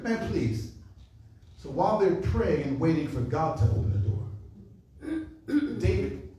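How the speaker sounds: ordinary talk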